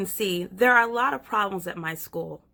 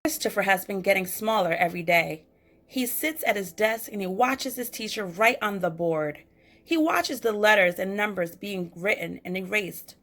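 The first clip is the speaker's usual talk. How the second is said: ordinary delivery, nothing marked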